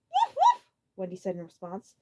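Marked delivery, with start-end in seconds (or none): 0.1-0.6 dog barking. A narrator's reading. Wendy barking.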